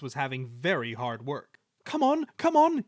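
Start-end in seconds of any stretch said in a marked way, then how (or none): 1.8-2.8 much higher, loud puffing strain; a tired engine urging itself on; puffing effort. Acted. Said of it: "he puffed".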